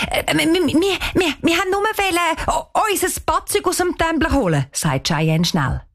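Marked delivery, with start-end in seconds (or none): 0.1-4.7 ertappt und hoch stammeln; stotternd. A narrator's reading. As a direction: verlegen über die eigenen Worte stolpern. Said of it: "Danisa stottert". (Mädchen stottert ertappt vor dem Hauswart)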